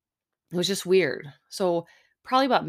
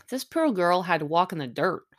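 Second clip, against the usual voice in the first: ordinary delivery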